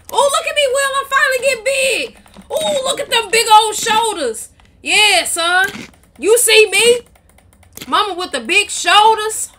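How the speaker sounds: high, loud gloating; excited boast. Pretend. Gamer showing Will the new big shoulders, excited boast.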